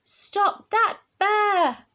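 high, loud, drawn-out call; storybook holler. A narrator's reading. Child after runaway Bear, loud high holler. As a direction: holler it slowly, stretching every word. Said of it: "I hollered".

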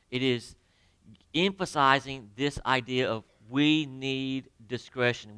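normal talking voice

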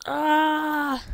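drawn-out acted wail; cry of pain. Burnt character cries in pain.

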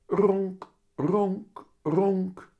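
boy pretending to purr. A narrator's reading. Willem shows mum he is content, high pretend purr. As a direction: imitate a cat's purr in a high child voice.

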